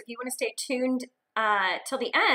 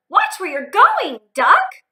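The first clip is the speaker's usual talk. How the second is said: higher, louder scolding; annoyed chicken; 8 semitones higher, 12 dB louder, much slower than usual. Acted. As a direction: snap at Duck to look out.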